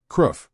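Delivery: short harsh beastly growl. Acted. Possessed man growls at the one trying to save him. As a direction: snarl it like a cornered beast. Man fighting his inner demon.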